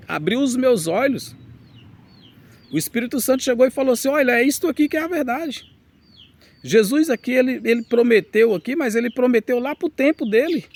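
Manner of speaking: high, loud impassioned preaching; fervent preaching. Real, not acted. Pastor to his flock, preaching at full force. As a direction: preach it out with rising fire.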